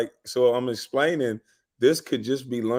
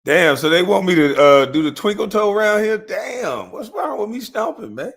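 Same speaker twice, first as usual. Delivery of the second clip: loud, higher, disbelieving protest; incredulous outburst; 8 semitones higher, 5 dB louder than usual. Real, not acted. Complaining to the panel about being told to tone down, loud and incredulous. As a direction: throw it out loud like you can't believe it. Mock outrage over being hushed.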